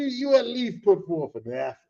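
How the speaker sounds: ordinary talk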